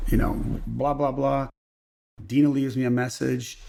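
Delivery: ordinary delivery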